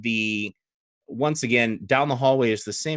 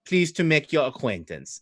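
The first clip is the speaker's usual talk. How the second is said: put-on flustered, higher French-accented introduction; 4 semitones higher than usual. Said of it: "French in this case would be Old Adari".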